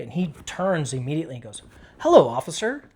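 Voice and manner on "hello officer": high, loud, chirpy hello; bubbly greeting. Retold or imitated. Suspect greets officer at his door, bubbly. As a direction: greet the cop all sunny and upbeat. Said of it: "Like, just very bubbly".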